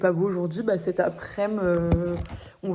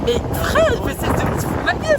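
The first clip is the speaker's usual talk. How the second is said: high-pitched indignant outburst, louder; outraged; 4 dB louder than usual. Real, not acted. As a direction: protest loudly at your friend. Outraged at friend over the cold.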